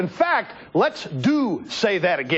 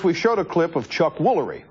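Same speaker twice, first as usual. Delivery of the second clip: ordinary talk, nothing marked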